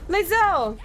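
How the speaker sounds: loud high cry of her name; relieved cry. Real, not acted. Player seeing Lae'zel alive, relieved loud cry. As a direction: call out in sudden relief. Companion survives unexpectedly.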